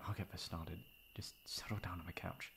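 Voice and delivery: soft unvoiced whisper. Friend whispers to upset guest while making tea, softly. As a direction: hush it out gently.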